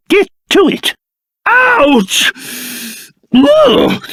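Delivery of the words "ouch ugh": pained yelps. Acted. King hit, startled cries.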